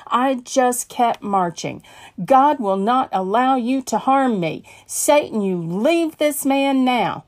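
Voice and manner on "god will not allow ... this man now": rebuking command. Acted. Facing the gunman, rebuking Satan.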